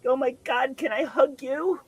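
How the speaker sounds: very high, tearful imitation; high, weepy impression of overwhelmed gratitude; mock sobbing. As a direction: plead for a hug through pretend sobs. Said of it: "and again, sobbing".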